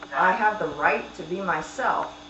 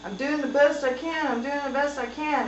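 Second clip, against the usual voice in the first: raised pitch, pleading husband; husband defending himself; 7 semitones higher than usual. Retold or imitated. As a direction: quote him whining that he's trying hard. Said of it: "just said".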